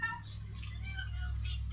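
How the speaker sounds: put-on bright, high read-aloud for kids; storytelling voice. A narrator's reading. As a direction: read it like a bedtime story to little ones.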